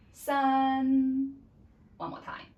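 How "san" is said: "san" held loud, high and level; high flat tone. Shown as a demonstration. Teacher counts together with pupils, high flat tone. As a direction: keep "san" up on one note. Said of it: "keep at that pitch".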